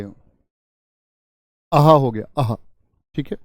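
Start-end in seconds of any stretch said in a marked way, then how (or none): 1.7-2.6 joy. Shown as a demonstration. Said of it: "हर्षबोधक".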